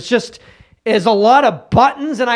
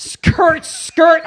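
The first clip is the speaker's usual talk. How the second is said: tire screech; 9 semitones higher than usual. Acted.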